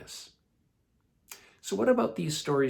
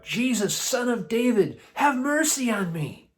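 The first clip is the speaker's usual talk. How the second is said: high, raised-voice cry for mercy; shouted plea; 10 semitones higher, 5 dB louder than usual. A narrator's reading. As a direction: call out pleadingly over a crowd. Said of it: "shouted out".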